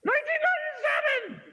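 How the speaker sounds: high, sudden yelled year to wake everyone up; jolting scream to keep listeners from nodding off. Real, not acted. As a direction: blurt it out in a startled high shout. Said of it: "I didn't mean to scream".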